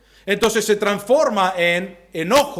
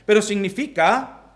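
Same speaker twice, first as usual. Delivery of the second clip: normal talking voice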